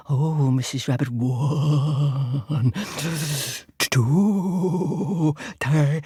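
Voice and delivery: loud, drawn-out menacing count; fox counting aloud. Acted. Fox about to pounce, slow loud counting. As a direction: stretch each number with jaws wide open. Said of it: "he started counting out loud".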